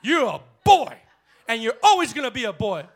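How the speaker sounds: full-force preaching. Real, not acted. Preacher shouting about discipline at home. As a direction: preach with fire.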